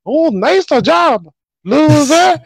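slow, high mocking taunt. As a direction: drag it out to mock him. Mario taunting Bowser.

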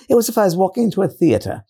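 put-on British accent. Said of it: "this British accent that she had".

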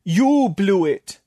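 slow, high, loud moan; long, wailing complaint. A narrator's reading. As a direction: moan it out in drawn-out blame. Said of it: "Tatok moaned".

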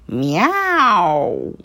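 cat meowing loudly. A narrator's reading. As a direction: call out a big miaow. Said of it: "jó hangosan".

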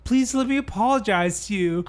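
put-on high, insistent, drunken begging; acted pleading. Drunk dancer begging his attacker to stop, pleading. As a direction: re-enact an absurdly polite desperate plea.

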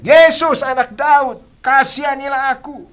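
desperate shouted plea, very high and loud; crying out. Retold or imitated. Blind man crying out to Jesus over the crowd. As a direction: shout it across the crowd for mercy. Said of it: "Dia berteriak".

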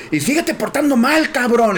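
high, slow scolding yell; mock yelled threat. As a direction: threaten the boy for onlookers to hear. (regaño gritado de broma)

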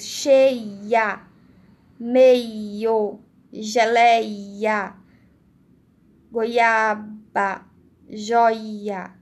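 "Cheia, meio, geleia, goiaba, joia" with each i sounded twice; loud, separated words, doubling the i in "Cheia, meio, geleia"; i pronunciado em dobro. Shown as a demonstration. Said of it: "como se pronunciasse o i duas vezes". ("Cheia, meio, geleia, goiaba, joia" com o i dito duas vezes)

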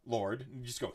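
normal talking voice

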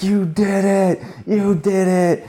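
high, long-drawn cheer without full volume; mock yell, each word stretched in triumph; imitated yell. Passionate sponsor cheering the dancers, imitated yell. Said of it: "and he's yelling".

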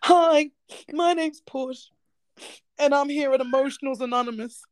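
a hint of a mock confessional introduction; support group introduction. Introducing oneself at Emotionals Anonymous, lightly mock-earnest.

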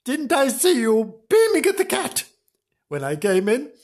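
high-pitched indignant question; mock domestic quarrel; accusing wife.

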